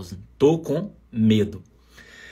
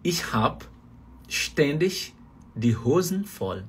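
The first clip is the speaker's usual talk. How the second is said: slow, careful phrase, drawn-out end; slow German demo; 2 semitones higher, 3 dB quieter, much slower than usual. Shown as a demonstration. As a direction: spell the sentence out slowly for learners. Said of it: "Você pode falar isso aqui, ó".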